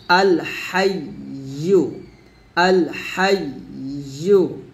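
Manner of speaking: আল হাইয়ু said slowly, the ইয়ু from the middle of the tongue; correct ya. Shown as a demonstration. A teacher modelling the ya in আল হাইয়ু. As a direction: stretch আল হাইয়ু, tongue on the palate. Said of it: "এই ইয়ার উচ্চারণ যখন আমরা করব".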